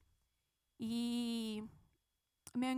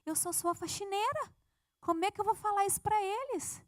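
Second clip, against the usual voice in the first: pleading protest; 6 semitones higher than usual. Retold or imitated. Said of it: "Eu falei".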